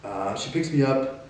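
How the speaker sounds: normal talking voice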